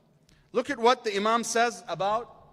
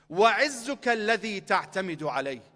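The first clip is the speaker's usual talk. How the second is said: rapid recited Arabic quote; quick Arabic recitation; much faster than usual. Acted. Preacher quoting the Imam's Arabic to the crowd, rapidly recited. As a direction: rattle off the source text.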